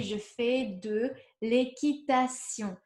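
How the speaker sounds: je fais de l'équitation said slowly and clearly; slow model sentence. Shown as a demonstration. Teacher slowly models the sentence for students. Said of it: "so you would say".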